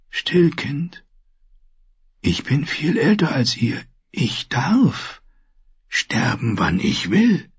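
put-on soft, almost whispered old voice; weak old maester, tender. Dying maester soothes little Rickon, soft whisper. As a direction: hush the child gently.

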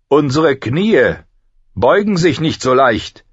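high, loud refusal; proud knight. Acted. Kingsguard knight refusing to swear to the victors, proudly firm.

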